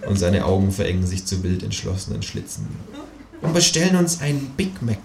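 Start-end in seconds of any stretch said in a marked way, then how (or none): 3.4-5.0 high kangaroo voice, held down in volume; high-pitched resolute figure; determined kangaroo. Acted. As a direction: plot the next move in a high, contained voice. Kangaroo finishes its revenge plan.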